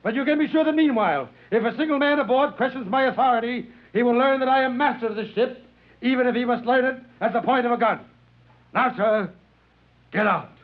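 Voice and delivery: furious captain threatening a passenger; captain shouting. Acted. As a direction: roar at him that you are master of this ship. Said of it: "I could hear the captain shouting".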